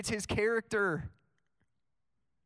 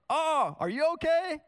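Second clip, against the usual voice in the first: high, loud, startled check-in; acted-out burst of worry; concerned dad; 9 semitones higher, 5 dB louder than usual. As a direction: imitate a dad's surprised concern, pitch leaping up. Dad voiced reacting to accident.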